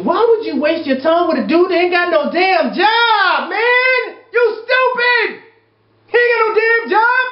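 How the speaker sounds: high yelling, stretching out "stupid"; furious shout. Real, not acted. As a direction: blow up at the reader. Ranting at letter writer.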